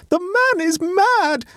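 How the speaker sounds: slow, very high, theatrical despair; melodramatic, drawn-out high cry; tragic exclamation. A narrator's reading. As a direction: wail it out like a tragedienne. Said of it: "exclaimed Adela tragically".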